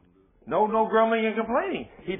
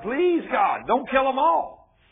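higher, louder, slow plea; pleading Moses; 7 semitones higher, 6 dB louder, much slower than usual. Acted. Moses pleading with God for the people, high and loud. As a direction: beg God to spare them. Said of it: "He started pleading with God".

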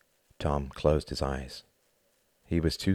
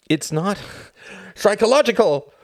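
loud and much higher, bursting with bile; cried out; 8 dB louder than usual. Acted. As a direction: let it erupt in anger. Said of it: "cried Tom".

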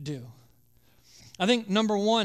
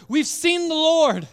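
higher, louder and slowed, bursting with news; excited disciples; 8 semitones higher, 9 dB louder, slower than usual. Acted. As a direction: announce it like the best news ever. Disciples tell Thomas the news.

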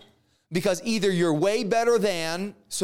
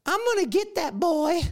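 much higher villainess voice, threatening; menacing Jezebel; 11 semitones higher than usual. Acted. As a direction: voice the wicked queen vowing revenge, pitched way up.

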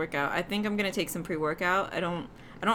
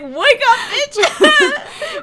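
mock yell; 10 dB louder, much slower than usual. Mock rude wake-up call.

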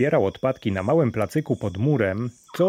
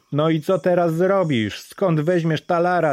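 higher, louder jeering question; mock the beggar; mocking taunt; 7 semitones higher, 4 dB louder than usual. A narrator's reading. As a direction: crowd taunting, much higher. Crowd taunts Dari.

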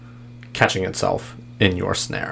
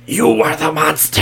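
booming monster voice, raised and loud; booming arrogant alien overlord; 7 semitones higher, 4 dB louder than usual. Acted. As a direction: loom over them, contemptuous. GM voices river creature.

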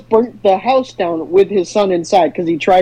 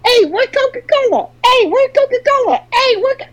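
much higher jingle-like ad voice; 12 semitones higher than usual. Acted. Playing the sound guy's ad, high squeaky voice. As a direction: chirp the ad line way up high. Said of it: "Stop playing the ad".

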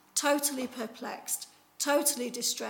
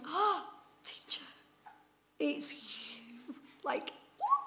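awed recognition; 5 semitones higher, slower than usual. Acted. Mary recognises risen Jesus.

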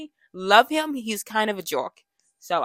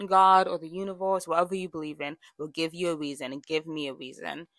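ordinary talk

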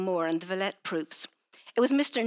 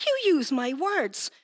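she suddenly realises he is quoting her own words; astonished outburst; 11 semitones higher, 4 dB louder than usual. A narrator's reading. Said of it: "rising defiantly, astonishment".

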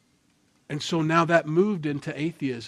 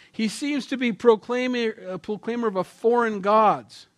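mocking Athenian; 9 semitones higher, 5 dB louder than usual. Reading mocking Athenians' words.

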